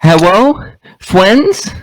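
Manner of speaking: put-on high, sugary cutesy baby talk; squeaky cute uwu voice. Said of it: "Do it in the uwu voice".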